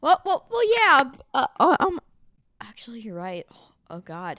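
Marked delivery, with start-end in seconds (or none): 2.6-4.4 whispering. Real, not acted. Sheepish aside.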